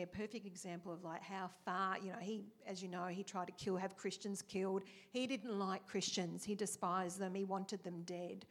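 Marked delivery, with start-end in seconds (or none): none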